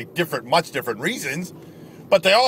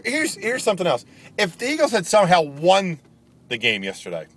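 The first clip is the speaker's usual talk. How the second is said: usual speaking voice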